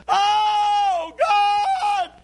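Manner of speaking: a high, loud cry of agony; retold scream. Drunk driver in the next room screaming in pain, a high agonized shout. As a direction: scream it like a man in unbearable pain. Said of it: "he was screaming".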